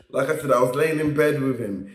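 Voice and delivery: ordinary delivery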